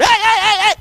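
high, loud repeated "hey"; excited hollering. Retold or imitated. Preacher imitating an excited holler he never used to do. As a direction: holler like a cheerleader. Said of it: "I didn't grow up".

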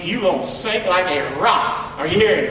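usual speaking voice

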